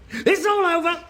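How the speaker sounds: building to a high, drawn-out wail; mock hysteria. Hysterical over father's madness.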